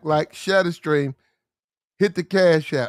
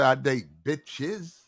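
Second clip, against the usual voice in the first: slow quoted phrase; 4 semitones lower, 8 dB quieter, much slower than usual. Retold or imitated.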